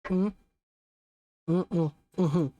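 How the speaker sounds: humming with soda in mouth; closed-mouth mm-hmm, mouth full. Real, not acted. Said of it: "I had soda in my mouth".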